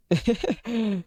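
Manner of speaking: quick high burst of laughter; short high laugh. Real, not acted. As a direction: laugh off the insult. Laughs after cursing at friends.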